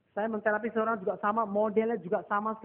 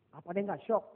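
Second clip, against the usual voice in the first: ordinary delivery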